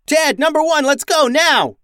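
high, loud rallying yell; urgent shout. A narrator's reading. Captain rallies crew to escape, urgent yelling.

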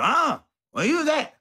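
put-on high startled half-asleep girlfriend voice; mock suspicious girlfriend. Girlfriend wakes up and catches him, high suspicious voice. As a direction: play her jolting up, high and accusing.

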